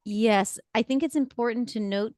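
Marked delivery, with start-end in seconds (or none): none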